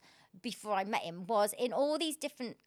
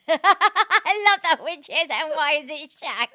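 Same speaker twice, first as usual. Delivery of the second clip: laughing; 7 semitones higher, 13 dB louder, slower than usual. Real, not acted. Laughing at the host's question.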